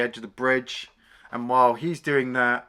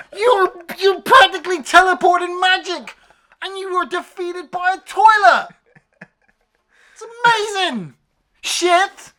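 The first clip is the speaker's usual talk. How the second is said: very high, loud comic shouting; incredulous rant; 10 dB louder than usual. Acted. Reviewer berating the monster beaten by a toilet, incredulous shouting. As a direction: shout it in outraged, delighted disbelief. Ranting at absurd toilet defeat.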